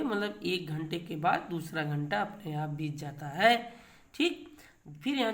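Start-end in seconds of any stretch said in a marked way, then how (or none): none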